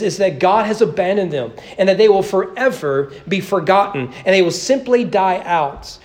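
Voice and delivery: ordinary delivery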